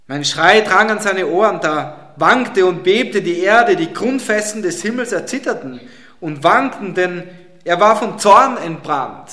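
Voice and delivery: solemn, weighty psalm reading; preacher reads the psalm to the congregation, solemn and weighty; scripture reading. Acted. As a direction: read the psalm like a grand proclamation.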